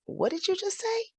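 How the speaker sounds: very high, incredulous what did you just say; reliving the shock of hearing it; stunned disbelief. Retold or imitated. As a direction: shoot the pitch up in shock. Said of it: "I remember saying".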